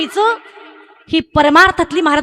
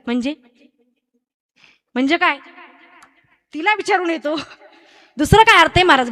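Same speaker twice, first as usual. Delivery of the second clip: usual speaking voice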